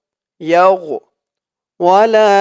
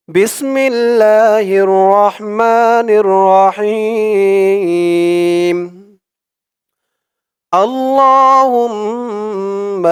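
slow, drawn-out Arabic chant; slow Quranic recitation; 3 semitones higher, much slower than usual. Shown as a demonstration. Teacher reciting for his viewers in slow chant. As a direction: recite each word long and melodic.

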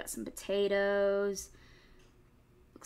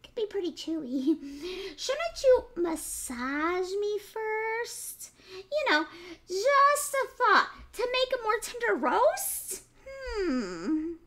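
much higher, sweet piglet, slow on massage me; clever piglet voice; 11 semitones higher, 6 dB louder than usual. Acted. Piglet sweetly talks the fox into massaging him.

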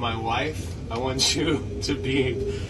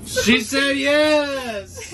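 high, joyful cry; overjoyed, laughing shout; excited shout; 12 semitones higher, much slower than usual. Real, not acted. Proposal accepted, cheering.

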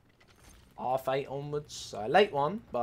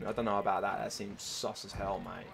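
normal talking voice

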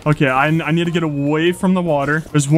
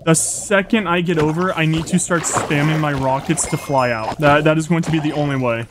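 normal talking voice

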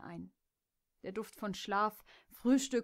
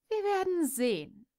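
playful growl; 9 semitones higher, 5 dB louder than usual. Acted. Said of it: "knurrte Jess spielerisch".

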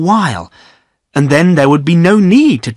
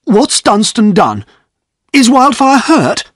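higher, slow cross demand; crossly; 9 semitones higher, 4 dB louder than usual. Acted. Godfrey demands to know what Dunstan did, slow and cross. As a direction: demand the truth, rising alarm. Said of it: "asked Godfrey crossly".

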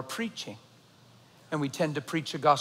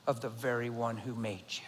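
whisper; 6 semitones lower, 9 dB quieter than usual. Real, not acted. Sermon line trails into hush.

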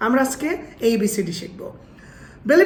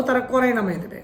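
normal talking voice

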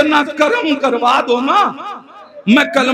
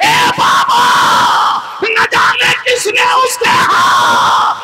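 lamenting sermon climax; 9 semitones higher than usual. Acted. Preacher voicing the daughter's lament for Karbala, in a high tearful shout. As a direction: cry out the grief of Karbala to the mourners.

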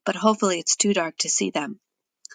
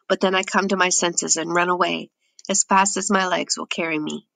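plain reading voice

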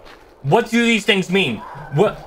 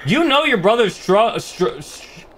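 stuck on the word, repeating str-; real stutter, trailing off into a breathy stall; tongue-tied, can't get the word out; slower than usual. Real, not acted. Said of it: "I'm stuttering now".

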